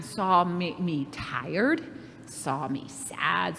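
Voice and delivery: soft, slow, sad delivery. Shown as a demonstration. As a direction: let the words sink sadly. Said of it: "saw my reaction".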